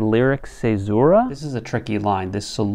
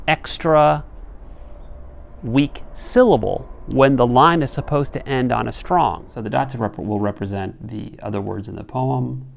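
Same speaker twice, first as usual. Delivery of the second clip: ordinary talk, nothing marked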